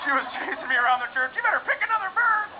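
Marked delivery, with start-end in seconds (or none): 1.3-2.5 high-pitched scolding mom; angry mother. Retold or imitated. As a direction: imitate an angry mother mid-chase. Angry mother chasing her child in church.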